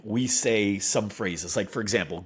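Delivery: ordinary talk, nothing marked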